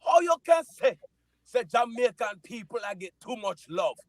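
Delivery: put-on high, loud Jamaican accent, protesting; hammed-up Jamaican protest. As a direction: fire back in a big Jamaican accent. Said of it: "they showing too much love for the Jamaican sprinters".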